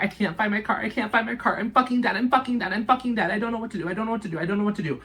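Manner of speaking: frantic, repeating herself in terror; lost in a parking lot, screaming to her best friend on the phone in a panic; panicked shrieking. Retold or imitated. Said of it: "I'm over here screaming to her".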